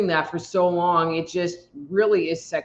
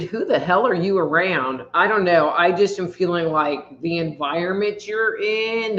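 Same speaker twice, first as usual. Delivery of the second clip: normal talking voice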